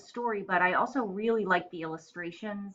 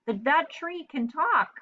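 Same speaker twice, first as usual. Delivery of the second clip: much higher squawky parrot voice; startled parrot; 11 semitones higher, 3 dB louder than usual. Acted. Parrot amazed the tree talks, high and baffled. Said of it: "the parrot".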